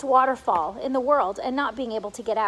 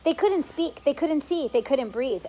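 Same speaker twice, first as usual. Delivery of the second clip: ordinary delivery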